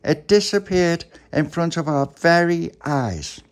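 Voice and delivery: high, loud, slow proclamation; slow-building wonder, word by word; amazed. Acted. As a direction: draw it out in astonishment. Charlotte marvels at vanished bird.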